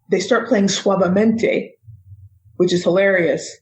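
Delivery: usual speaking voice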